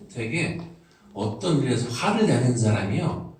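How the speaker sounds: ordinary talk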